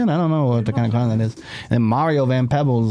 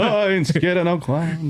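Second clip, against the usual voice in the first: slow, higher, put-on Martin voice; Martin impression; 6 semitones higher than usual. Friend echoes the boast in a put-on Martin voice. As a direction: echo the boast as Martin would. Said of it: "I thought you were going into Martin".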